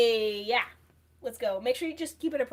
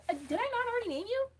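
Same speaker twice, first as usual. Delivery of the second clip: surprised; 11 semitones higher, faster than usual. Real, not acted. Sudden high reaction.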